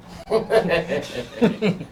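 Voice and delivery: amused burst of laughter; disbelieving chuckle; laughing. Real, not acted. Laughing at artist's answer.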